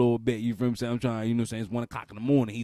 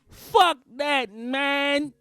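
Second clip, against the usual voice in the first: high, loud defiant cry; hammed-up indignant yell; exasperated outburst; 11 semitones higher, 4 dB louder, much slower than usual. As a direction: blurt it out in fed-up protest. Acts exasperated parent hitting back.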